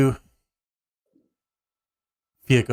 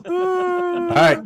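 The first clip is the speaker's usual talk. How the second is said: laughing. Real, not acted. GM laughing then resuming.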